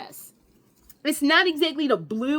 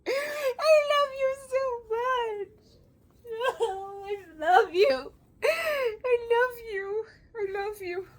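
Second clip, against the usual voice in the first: tearful love; 10 semitones higher, slower than usual. Real, not acted. Crying over the dress.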